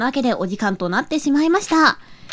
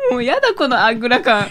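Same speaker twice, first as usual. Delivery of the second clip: giggling through the words; laughing; 10 semitones higher than usual. Real, not acted. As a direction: crack up at your own answer. Cracking up at answer.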